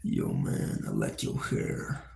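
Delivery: very low, slow hushed big-dude voice; low menacing big guy. Acted. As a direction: rumble it like a huge guy. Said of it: "a really big dude".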